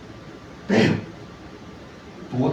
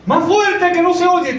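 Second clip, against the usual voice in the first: put-on high, loud shout of an old woman from the back pew; old lady yelling; 11 semitones higher, 5 dB louder, slower than usual. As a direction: yell it up to the preacher like a deaf old lady. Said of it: "vuelve y dice lo mismo".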